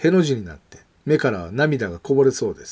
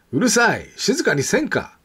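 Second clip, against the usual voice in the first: high-pitched scolding, moderately raised voice; angry yell; 8 semitones higher than usual. A narrator's reading. Old neighbour scolding kids playing ball, angry yell. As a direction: tell them off as a cross old neighbour. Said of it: "すごい声で怒鳴りました".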